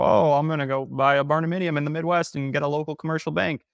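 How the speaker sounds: lightly put-on eager outsider; naive Californian. Imagining a naive California investor aloud, naive Californian.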